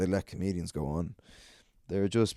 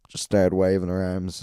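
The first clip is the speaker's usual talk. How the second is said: usual speaking voice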